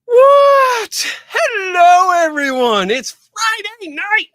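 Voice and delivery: big, high shouted hello to the crowd; loud greeting. Real, not acted. Host bursting onto the stream, greeting everyone with a high shout.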